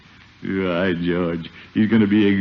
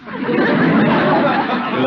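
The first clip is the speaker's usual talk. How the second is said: laughing well. Acted. Commissioner amused by Peavy's bologna line, laughing. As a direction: laugh through the word.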